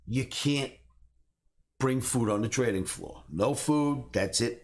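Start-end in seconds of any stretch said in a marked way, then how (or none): none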